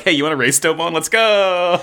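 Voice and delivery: eager shout-out, climbing in pitch; excited challenge. Retold or imitated. Master daring his pupil to race, with rising excitement. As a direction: build to a high, gleeful launch.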